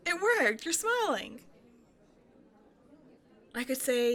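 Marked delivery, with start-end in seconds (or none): 0.1-1.4 higher and louder, thrilled it worked; delighted. Real, not acted. Excited at the stranger's smile, pitched up and louder. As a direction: burst out, proud the compliment landed.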